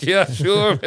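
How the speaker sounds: high, laughing "yeah, sure"; amused agreement. Retold or imitated. Newcomer accepting a kippah at the door, high and laughing.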